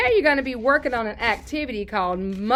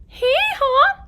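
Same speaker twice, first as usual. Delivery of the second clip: high, loud hee-haw; donkey bray; 6 dB louder than usual. Shown as a demonstration. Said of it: "What about that donkey?".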